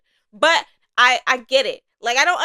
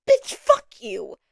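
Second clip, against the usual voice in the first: mock exasperation; 5 dB louder, slower than usual.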